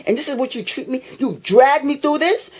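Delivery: ordinary delivery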